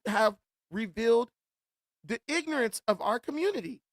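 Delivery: normal talking voice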